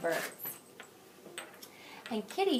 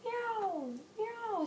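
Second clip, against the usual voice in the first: high cat meow, much higher; 12 semitones higher than usual. Shown as a demonstration. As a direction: mew like a kitty. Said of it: "What do they do sometimes".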